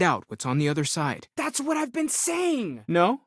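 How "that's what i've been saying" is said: high-pitched, drawn-out exclamation of agreement; excited vindication. Acted. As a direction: cry it out high, finally being agreed with.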